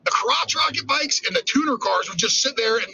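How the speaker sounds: normal talking voice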